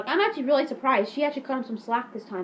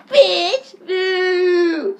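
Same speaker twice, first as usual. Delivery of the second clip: loud, high yelled insult; hammed-up shout and drawn-out boo; mock jeer; 7 semitones higher, 9 dB louder than usual. [name] insults his teacher, loud mock jeer.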